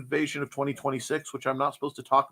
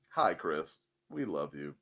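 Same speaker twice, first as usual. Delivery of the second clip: much lower and softer, tender; soft low greeting; 13 semitones lower, 10 dB quieter than usual. Real, not acted. Greeting viewer Chris in chat, low and soft. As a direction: murmur it fondly.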